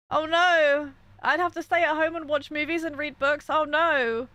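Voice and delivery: mock dismay. Sarcastic about staying home.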